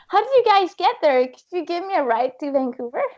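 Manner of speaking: high, eager polite request; overly polite asking. Retold or imitated. A tired paddler sweetly asking strangers for a ride, high. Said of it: "I'm trying to be polite".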